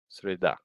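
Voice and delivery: среда with the beat on the last syllable, sri-DA; среда modelled as сре-ДА; correct final stress. Shown as a demonstration. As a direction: land firmly on да in среда. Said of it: "but it's not среда".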